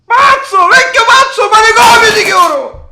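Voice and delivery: high, loud desperate yelling; panicked screaming. Real, not acted. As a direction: scream to stop the wrecking. Grandson screams as grandpa smashes things.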